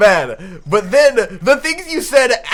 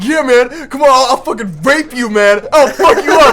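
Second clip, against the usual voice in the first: loud, higher mock aggressive drunk; mock drunk yelling; 4 semitones higher, 4 dB louder than usual. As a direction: ham up a drunk picking a fight. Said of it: "you were just like".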